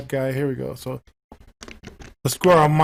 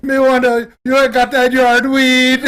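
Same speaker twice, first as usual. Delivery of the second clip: hammed-up Jamaican patois; mock Jamaican accent; 5 semitones higher, 8 dB louder than usual. Mocking the narrator's fake Jamaican accent. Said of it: "Straight from the island".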